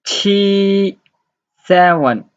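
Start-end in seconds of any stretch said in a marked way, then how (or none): 0.0-1.0 Qi held long, high and level; level Mandarin tone on Qi, no fall; first tone. Shown as a demonstration. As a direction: hold Qi on one steady high note. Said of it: "first tone".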